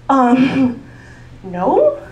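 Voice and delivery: sarcastic comeback. Retold or imitated. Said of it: "I'm like".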